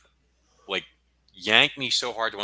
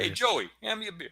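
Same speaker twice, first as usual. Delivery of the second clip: put-on Brooklyn accent. Brooklyn bit continues.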